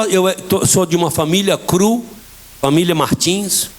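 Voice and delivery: normal talking voice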